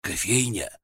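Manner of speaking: hoarse and drowsy, slow and soft; hoarse sleepy voice. Acted. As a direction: croak it out half asleep. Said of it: "хриплым сонным голосом".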